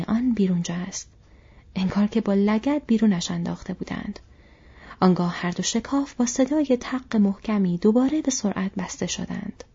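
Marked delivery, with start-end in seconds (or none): none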